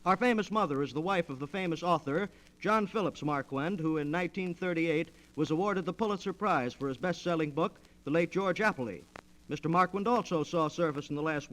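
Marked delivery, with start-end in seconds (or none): none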